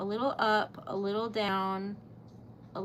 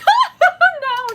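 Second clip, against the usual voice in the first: burst of laughter; 14 dB louder than usual. Real, not acted. Laughing at her own genius joke.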